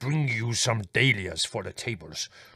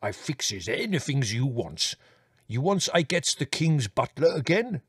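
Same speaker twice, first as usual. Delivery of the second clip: put-on thick-accented, helpful assurance; drawn-out broken English, lilting up into the offer; comforting Spiro; 4 semitones higher than usual. A narrator's reading. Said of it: "said Spiro comfortingly".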